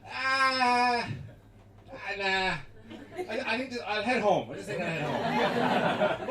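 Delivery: drawn-out, hesitant excuse; reluctant. Acted. Jesus excusing himself to the apostles, hammed-up reluctance. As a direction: wriggle out of it awkwardly.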